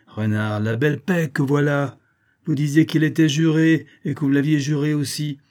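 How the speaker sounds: mildly indignant storyteller's character voice; reproachful. A narrator's reading. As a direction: scold him for a broken oath. Mésange reproaches fox's false peace.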